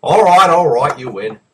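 loud, high concession; mock surrender. Acting out the joke's surrender.

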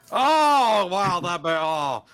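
yelping through a sour candy; high startled yelps at the sour taste; eating sour candy. Real, not acted. Do you wanna try it?.

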